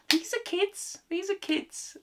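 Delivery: high-pitched appalled squeal, softening; aghast, high little protest. Acted. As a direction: squeak it out in mock horror. Acted appalled reaction to the film.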